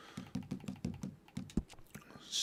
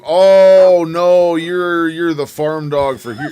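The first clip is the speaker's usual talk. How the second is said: sudden loud alarmed cry, higher; alarmed outburst; 4 semitones higher, 12 dB louder, slower than usual. Real, not acted. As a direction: blurt it out loud as the dog turns. Dog turns on him.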